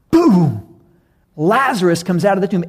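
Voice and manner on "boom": loud spoken boom; preacher's mock boom; booming sound effect. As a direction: drop it like a thunderclap.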